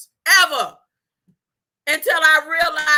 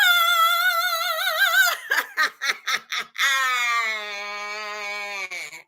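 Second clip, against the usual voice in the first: long hearty laugh. Real, not acted.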